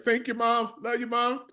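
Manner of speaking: high, put-on grateful voice; award-winner thanks. Award winner thanking a mother, mimicked high gratitude. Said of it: "if you go to an award show".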